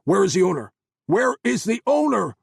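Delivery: loud, high furious demand; angry shouting. Acted. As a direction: bark it in his face. Soldier demands answers.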